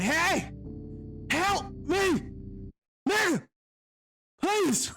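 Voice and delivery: stranded soldier shouting to the ATV rider; shouting for help. Retold or imitated. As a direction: yell for help across the snow. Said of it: "how I was able to shout".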